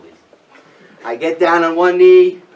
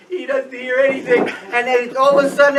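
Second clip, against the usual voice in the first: normal talking voice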